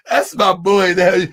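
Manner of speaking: ordinary talk, nothing marked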